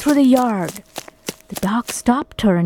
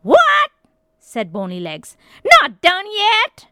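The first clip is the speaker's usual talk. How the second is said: startled witch shriek; 10 semitones higher, 4 dB louder than usual. A narrator's reading. Witch is surprised.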